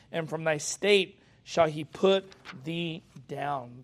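slow preaching read; scripture reading. Acted. Preacher reading Isaiah to his church, slow and solemn. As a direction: proclaim the judgment, slowly.

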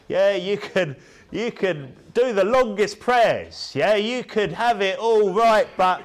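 rising from loud to shouting; building preaching. Real, not acted.

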